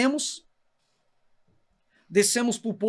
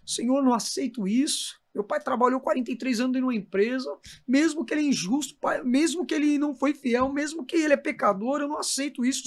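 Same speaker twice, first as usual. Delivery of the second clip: tearful prayer; 6 semitones higher than usual. Retold or imitated. Son praying for his father outside the hospital, pleading. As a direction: plead with God, voice strained. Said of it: "Comecei a orar".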